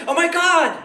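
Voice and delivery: high-pitched, over-eager gush; mock excited gushing. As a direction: imitate a thrilled fellow Bostonian. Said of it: "if they're from Massachusetts, they're like".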